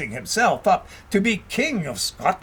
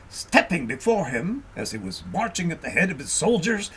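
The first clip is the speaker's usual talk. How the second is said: ordinary delivery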